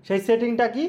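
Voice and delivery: ordinary talk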